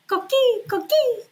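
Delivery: high chirping frog call; coqui frog call. Retold or imitated. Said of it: "And he goes".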